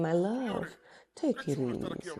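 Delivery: soft, low, placating murmur; soothing wife. Acted. Preacher voicing wives handling their husbands, soothing. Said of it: "They're the ones that say".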